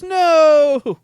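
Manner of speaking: a sudden high, loud mock "No!"; emphatic no. Girlfriend firmly refusing in a loud, high mock no. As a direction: fire back a loud, high no. Retold text reply, emphatic no.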